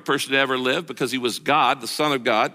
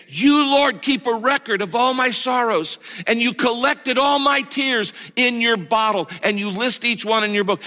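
higher, emphatic second reading with "You" lifted up; fervent rereading; 6 semitones higher than usual. Acted. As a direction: read it again with rising passion. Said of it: "Let me read it again".

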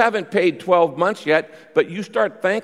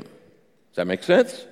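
ordinary talk, nothing marked

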